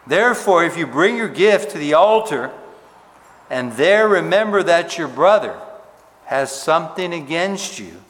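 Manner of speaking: booming pulpit reading of the Gospel; scripture reading. Acted. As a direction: read the passage out like a preacher at full voice. Reads scripture passage aloud.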